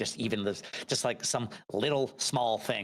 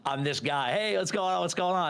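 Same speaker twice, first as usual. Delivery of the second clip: hyped-up fake-cheerful streamer hello; cheery stream persona; 7 semitones higher than usual. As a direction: put the happy mask on and greet chat brightly. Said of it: "just put the mask on and said".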